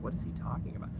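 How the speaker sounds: hushed aside between trainees; whispered confusion. Retold or imitated. Trainees whispering in formation, baffled whisper. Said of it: "We're like".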